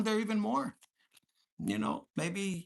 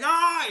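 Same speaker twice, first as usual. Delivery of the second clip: high, loud "Ah!" cry; mimicked outburst at full volume; imitated loud yell; 12 semitones higher, 12 dB louder than usual. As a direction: yell out like he did. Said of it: "he's loud".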